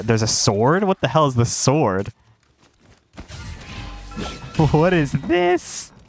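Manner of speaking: ordinary delivery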